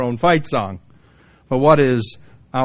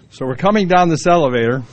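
ordinary talk

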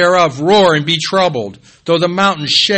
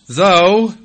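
THOUGH drawn out, loud and raised in pitch; a big, weighty THOUGH, higher and louder; big emphatic THOUGH; 5 semitones higher, 6 dB louder than usual. Real, not acted. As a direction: lean hard on the word THOUGH. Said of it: "that's a big though".

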